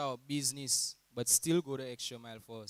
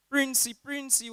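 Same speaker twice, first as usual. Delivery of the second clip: alarm call; 11 semitones higher, 5 dB louder than usual. Retold or imitated. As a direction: sound the alarm to scatter. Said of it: "that alarm call".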